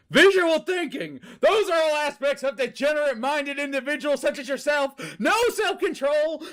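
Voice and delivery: high, loud pompous sneering rant; high, shouting contemptuous sermon; villain's tirade. Acted. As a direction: deliver it like a preening zealot. Villain's tirade at homeless man.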